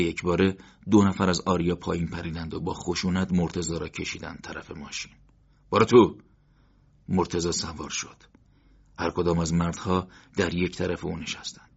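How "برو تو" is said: loud barked command; harsh order. A narrator's reading. Thug forcing Morteza into the car, barking. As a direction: shove him in with your voice. Thugs shove him in.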